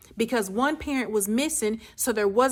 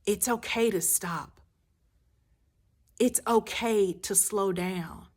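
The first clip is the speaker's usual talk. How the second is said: usual speaking voice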